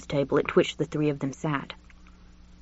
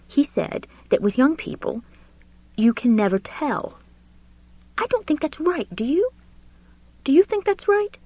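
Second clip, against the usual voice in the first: pitched well up, appealing for agreement; a genteel old man asking to be agreed with; high indignant Julian; 11 semitones higher than usual. Acted. Julian pleads with Richard, high and indignant.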